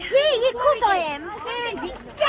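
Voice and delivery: high, drawn-out shouted reply; calling out. Acted. Calling back to a friend at parting, shouted.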